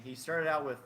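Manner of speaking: usual speaking voice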